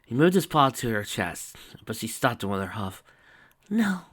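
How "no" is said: whispered. Acted. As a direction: stop him tenderly. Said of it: "she whispered".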